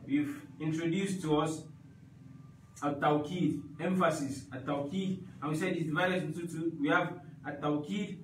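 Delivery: ordinary talk, nothing marked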